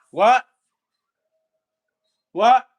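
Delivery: startled what. Real, not acted. Startled reaction to chat.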